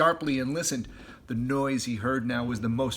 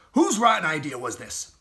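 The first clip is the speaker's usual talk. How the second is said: cross Bogus; 3 semitones higher, 5 dB louder than usual. Acted. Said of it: "said Bogus".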